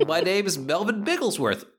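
a government man announcing he's here to help; government official voice. Acted. NPC introducing himself to the party as a cheerful official.

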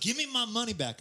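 high-pitched demanding customer; irate customer. Retold or imitated.

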